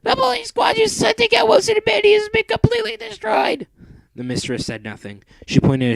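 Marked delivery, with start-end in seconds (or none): 0.0-3.7 extremely high squeaky robot voice; squeaky Becky. Acted. Becky bringing bad news to the Mistress, extremely high squeak. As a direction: squeak out the alarm.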